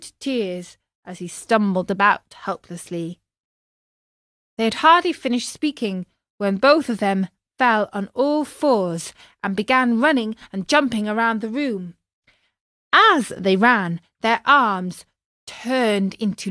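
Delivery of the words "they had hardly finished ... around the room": plain reading voice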